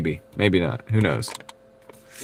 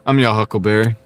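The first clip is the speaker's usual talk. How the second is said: ordinary delivery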